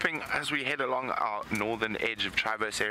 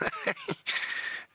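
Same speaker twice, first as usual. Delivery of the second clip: airy laugh. Real, not acted. Full vulture amusement.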